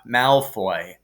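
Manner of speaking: ordinary delivery, nothing marked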